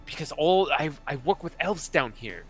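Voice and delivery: lightly put-on, lilting goblin; lightly voiced goblin. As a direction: explain hurriedly as the goblin. Game master plays goblin.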